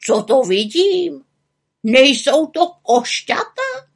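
high, drawn-out amazement; wide-eyed wonder, loud and high; astonished raven. Acted.

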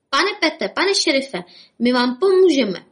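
high-pitched, eager little rabbit calling up. A narrator's reading. Bobek offers the sheriff help, calling out eagerly. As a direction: call out brightly to get attention, pitched high. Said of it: "postavil se před židli a zvolal".

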